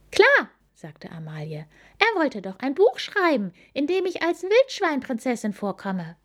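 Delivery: put-on high, quick childlike voice; young wild boar girl. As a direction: play the cheerful little piglet. Amalie figure voice. (hohe Kinderstimme)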